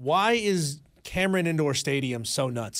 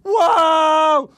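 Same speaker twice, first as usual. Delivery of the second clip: much higher, much louder wow yell; shouting over the big call; over-the-top wow shout; 13 semitones higher, 10 dB louder than usual. Retold or imitated. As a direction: bellow it like a hyped color man. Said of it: "his color commentary guy is just going".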